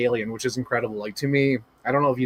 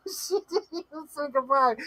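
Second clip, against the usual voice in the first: high, softer plaintive echo; mock whimper; 11 semitones higher, 5 dB quieter than usual. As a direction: whimper it through laughter, playfully hurt. Repeating chat's dismay after laughing.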